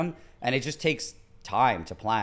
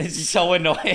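normal talking voice

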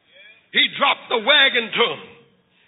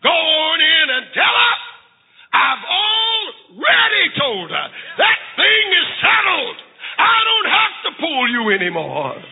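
triumphant defiance; 5 semitones higher than usual. Acted. Brother freed from sister's threat, triumphantly defiant. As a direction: declare it boldly, building higher.

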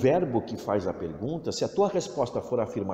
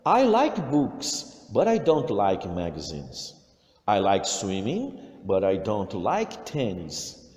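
slow, clear dictation for learners; a teacher reading a listening text aloud, slow and clear; slow English listening read; 4 dB louder, much slower than usual. Shown as a demonstration. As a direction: read it out slowly, word by word. Repeats the Anna text.

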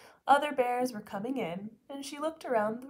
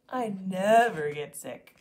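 stubborn; 3 semitones lower, 8 dB louder, slower than usual. Acted. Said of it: "sniffed Papa".

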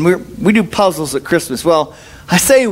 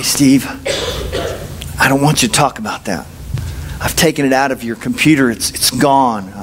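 a hint of a low, solemn God voice; lightly voiced deep divine pronouncement; 7 semitones lower than usual. God speaking to the pastor, lightly lower and solemn. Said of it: "to God say".

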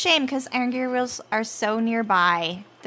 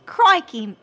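louder, higher mock-Aussie exclamation; Australian accent; 5 semitones higher, 6 dB louder than usual. Outdoing the Australian friend's crikey in a louder mock Aussie accent. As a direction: belt out a put-on Australian crikey. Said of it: "I think I say crikey better than you".

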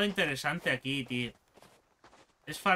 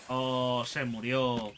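ordinary talk

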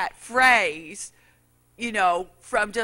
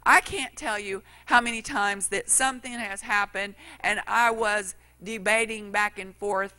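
ordinary talk, nothing marked

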